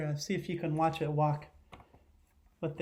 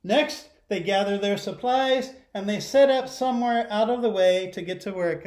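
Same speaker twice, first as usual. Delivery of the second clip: storybook read-aloud; 3 semitones higher, 7 dB louder than usual. Acted. Teacher reading a picture book to her class, lively read-aloud. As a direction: read it big so the whole class hears.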